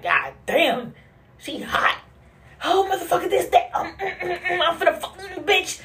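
high-pitched mock angry rant, half laughing; mock fury, way up high and laughing through it; mad rant. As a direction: squeal it out like someone fuming. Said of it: "you know how people that get mad".

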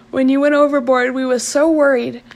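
lightly voiced old sailor, worried; gruff old fisherman. Alf relieved, worried.

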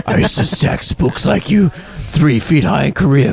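Clint impression. Quoting Clint character.

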